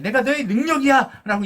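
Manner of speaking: preacher booming God's words to Moses; God's bold declaration. Acted. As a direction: declare it like God claiming his servant.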